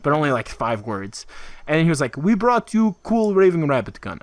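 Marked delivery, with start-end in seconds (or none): 2.2-4.2 put-on higher, botched French accent sliding into Russian; broken English in a muddled French-Russian accent; French turned Russian accent. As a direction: attempt French, land on Russian. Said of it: "why a French guy sounds Russian".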